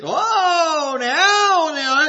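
whiny mock crying; hammed-up wail of regret; mock whimper. Animals beg for the bread.

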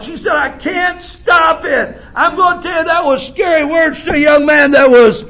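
high, ringing preacher's cry; preaching at full force. Acted. Preacher tells the church of his mother, full-force preaching. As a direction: preach it out to the congregation.

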